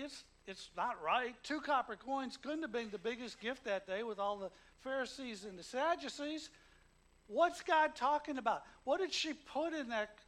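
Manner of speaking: loud, high preaching; full-force preaching. Acted. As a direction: preach it to the rafters.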